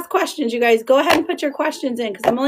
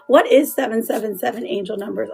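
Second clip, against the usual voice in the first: normal talking voice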